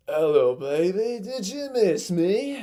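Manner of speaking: a deliberately awful Australian drawl, teasing; badly faked Australian accent, slow and playful; terrible Kano impression. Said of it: "I'm gonna do a terrible impression of their voice".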